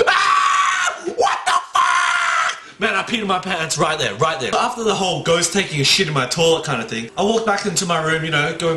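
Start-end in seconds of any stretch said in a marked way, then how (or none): 0.1-2.8 gasp, then very high drawn-out scream; terrified scream. Retold or imitated. Brother finding someone in the toilet, terrified scream. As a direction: act out a huge fright with a piercingly high shriek. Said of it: "she would be more scared".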